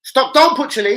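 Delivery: loud, high shout of stop; urgent shout. Retold or imitated. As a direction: stop him fast before the chili goes on. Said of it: "I've had to go".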